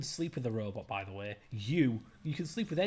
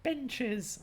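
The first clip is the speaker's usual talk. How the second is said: mock high voice; 11 semitones higher than usual. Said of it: "high-pitched voice".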